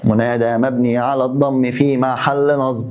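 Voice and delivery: dictating the full parsing; slow dictation. Shown as a demonstration. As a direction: read it out slowly for notes. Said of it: "dictating the parsing".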